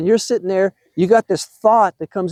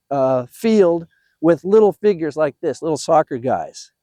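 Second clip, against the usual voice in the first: ordinary talk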